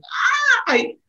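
high excited squeal of joy; excited gasp. Retold or imitated. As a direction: squeal like a thrilled bride. Bride's excited gesture imitated.

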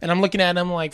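ordinary talk, nothing marked

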